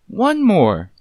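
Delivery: high, loud, haughty; imperious little command; condescending. Acted. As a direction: talk down to him. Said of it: "in a tone that felt almost condescending".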